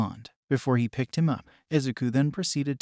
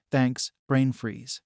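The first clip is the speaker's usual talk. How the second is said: slow teasing; much slower than usual. Acted. Teasing friend after the match.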